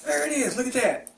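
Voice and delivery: high, delighted there it is; pleased reveal. Real, not acted. Host unveils the money clip to viewers, delighted. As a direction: show it off like a find.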